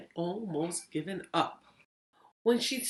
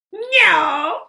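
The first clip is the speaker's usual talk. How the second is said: much higher, much louder, slow shouted goblin; 13 semitones higher, 13 dB louder than usual. Acted. Goblin taunts Lily with a deal, high slow shout. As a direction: bellow it like a gleeful goblin. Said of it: "smiled the goblin".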